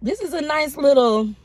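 talking with food in mouth; muffled speech between bites; chewing. Real, not acted. Seen 2: Bites donut before, holds it and chews while murmuring.

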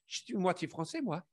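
proud line in a French accent; lightly put-on French accent. Shown as a demonstration. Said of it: "say it like that 'cause I could get that accent very good".

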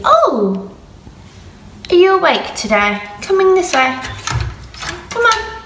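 high coaxing pet talk. Real, not acted. Coaxing her pet rabbit.

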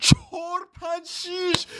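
very high squealed number call; high-pitched call-out. Real, not acted. Player calling out the dice roll, high squeal.

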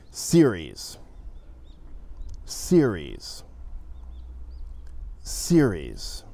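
series said the native English way; English word dictation. Shown as a demonstration. Teacher reads series for students to write. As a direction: read series clearly for dictation. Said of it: "say a word with my accent".

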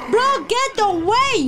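desperate repeated yell at a friend; drawn-out shout. Real, not acted. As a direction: stretch out the warning, pleading. Repeats warning more urgently.

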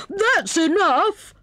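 high, drawn-out hare reprimand; severe. A narrator's reading. Hare pricked by the hedgehog's hug, severe. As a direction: put a stop to it sternly. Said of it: "said he severely".

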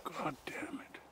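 whispered, under the breath; whispered curse. Real, not acted. Man pulling quills from his dog, whispering a curse.

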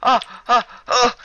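high startled shriek. Real, not acted. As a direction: squeal it out in shock.

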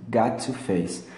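"Got to" with both T sounds kept; separated "got to". Shown as a demonstration. Teacher copying the live version's "Got to", T pronounced. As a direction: say "Got to face" with a crisp T. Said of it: "ele pronuncia assim mesmo".

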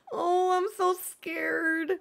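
high, slow hammed-up fear; mock scared. Streamer teasing chat before the reveal, hammed-up high mock fear. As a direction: play at being terrified, drawn out high. About to reveal new villager.

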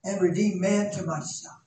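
loud, high, stretched preacher cry; climbing sermon fervor; full-force preaching. Acted. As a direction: preach it out to the whole church.

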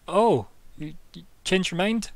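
high, pleasantly surprised question; high surprised waiter. Acted. Waiter man greeting Thea, high and surprised. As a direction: raise it up in glad surprise. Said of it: "he's like".

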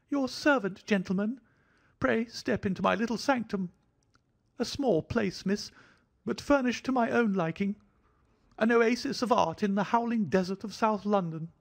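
fussy, jittery host bowing and scraping; thin high voice. A narrator's reading. Little man greeting Miss Morstan in a thin high voice. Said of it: "he kept repeating in a thin, high voice".

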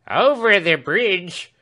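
high, loud, slow call. A narrator's reading. Servant calling that the coach crossed the bridge, loud. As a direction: shout the news across the yard. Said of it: "cried Britain".

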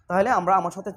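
ordinary delivery

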